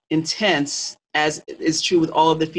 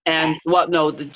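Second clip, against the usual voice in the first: usual speaking voice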